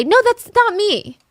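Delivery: high, drawn-out mock denial; mock protest. Imagined pupil denying blame to a teacher, high mock protest. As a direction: protest innocence in an exaggerated high pitch. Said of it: "He wouldn't say".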